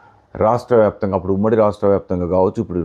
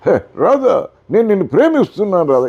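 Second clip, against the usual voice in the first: much higher heroic declaration of love; NT Rama Rao love dialogue; 11 semitones higher, 4 dB louder than usual. Retold or imitated. Hero declaring love to Radha, higher theatrical delivery. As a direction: proclaim love like an old film hero. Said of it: "ఈ టైప్లో ఉంటుంది".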